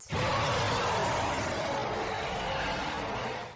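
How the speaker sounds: long breathy villain laugh; evil cackle. Acted. Dragon squeezing the struggling hero, a drawn-out evil laugh. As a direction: let out a slow wicked laugh.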